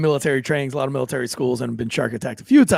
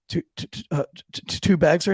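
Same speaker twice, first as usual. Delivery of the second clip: soft, halting recruit's answer; nervous stammer; 3 dB quieter than usual. Retold or imitated. Kid singled out by drill sergeant, stammering nervously. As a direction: trip over the first sound before getting it out.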